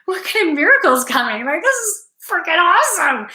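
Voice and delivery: high, fast, giddy gush; excited. Retold or imitated. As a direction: burst out with giddy wonder.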